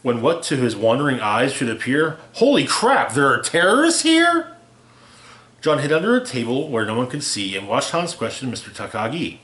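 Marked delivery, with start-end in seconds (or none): 2.3-4.6 high, loud startled cry, slowing at the end; shocked outcry. Acted. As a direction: blurt it out in alarm. John's shocked outcry in story.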